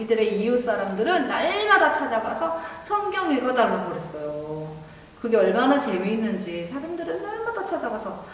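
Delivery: ordinary delivery, nothing marked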